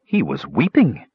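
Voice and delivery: understated astonishment, swooping pitch; note it with mild amazement; astonished. Acted. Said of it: "Cutwood noted in astonishment".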